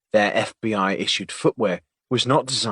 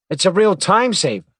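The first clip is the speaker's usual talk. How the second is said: high, louder, proud; pleased; 9 semitones higher, 7 dB louder than usual. Acted. Agent proudly sharing his ironing trick, pleased. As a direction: show off the tip. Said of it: "he said, happily".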